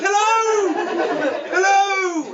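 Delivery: high, loud hello across the water; calling out. Acted. Passengers waving to each other on passing ferries, calling out. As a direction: holler a drawn-out greeting.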